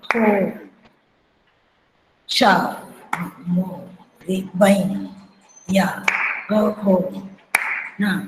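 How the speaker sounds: syllables at half tempo, long gaps between; slow tempo. Shown as a demonstration. Teacher showing how the slow version went, very slow tempo. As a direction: stretch each syllable out and wait before the next. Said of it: "और जो slow गाते थे".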